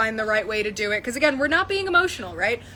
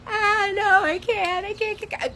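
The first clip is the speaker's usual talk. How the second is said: whiny reluctance; 9 semitones higher than usual. Retold or imitated. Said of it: "the entire time I was like".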